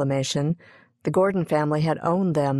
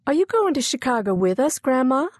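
put-on higher-pitched child voice; little girl's question; 9 semitones higher than usual. Young Daisy asks Grandma about leaving, high child voice. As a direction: ask it suddenly, pitched up like a child. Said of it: "Daisy suddenly asked".

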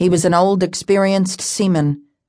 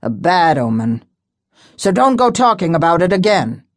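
put-on superstitious old seaman. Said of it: "he muttered".

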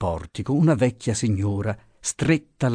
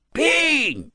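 even higher furious call of the name; angrier shout. A narrator's reading. Pasotti yelling again at the missing boatman, angrier. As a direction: bellow it, losing patience. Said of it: "gridava Pasotti, sempre più arrabbiato".

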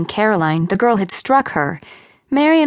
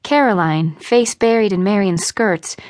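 ordinary delivery, nothing marked

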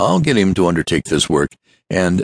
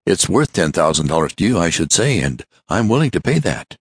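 fast, higher, tumbling words; rapid tones; 4 semitones higher than usual. Acted. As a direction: rush it out without pausing for breath. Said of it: "in rapid tones".

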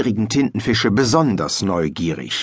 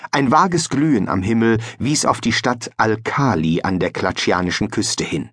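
ordinary delivery

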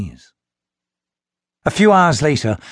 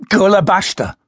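urgent exclamation; 8 semitones higher, 4 dB louder than usual. Acted. Guardian hisses foreign words.

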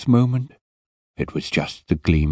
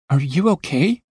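put-on nervous boy; 12 semitones higher than usual. Boy shocked by open wound.

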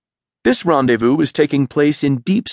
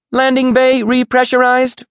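much higher, louder ship computer; 13 semitones higher, 4 dB louder than usual. A narrator's reading. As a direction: announce it like a ship's computer. Ship's computer announcement.

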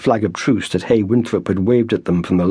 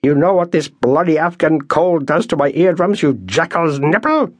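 screaming; 7 semitones higher than usual. Acted. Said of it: "He screamed at the man".

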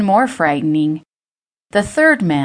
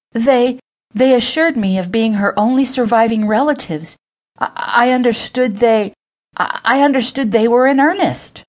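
higher-pitched, halting, nervous stammer; frightened stammer; 6 semitones higher, 3 dB louder than usual. A narrator's reading. Old woman excusing herself to three furious men, stammering. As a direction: stumble over repeated words, pleading in a higher voice. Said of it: "and stammered".

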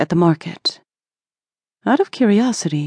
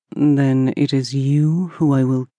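genie; 4 semitones lower, slower than usual. Acted. Jinn threatens the fisherman.

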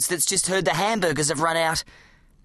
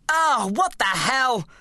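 yelling; 6 semitones higher, slower than usual. A narrator's reading. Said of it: "I yelled to myself".

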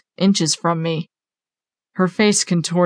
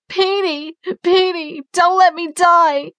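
very high, slow, plaintive plea; high-pitched pleading woman; dying mother's voice; 12 semitones higher than usual. A narrator's reading. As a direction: beg your child in a high, thin-pitched mother's voice. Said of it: "That was my mother's voice".

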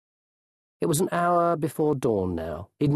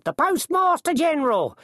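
high, raised announcement of a title; announcing coachman; 3 dB louder than usual. A narrator's reading. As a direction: call out the grand title for all to hear.

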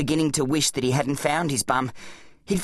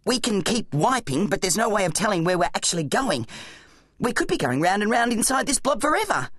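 higher-pitched, urgent explaining voice; Zack, an exasperated boy hero; 6 semitones higher than usual. Acted. Boy hero's voice in story.

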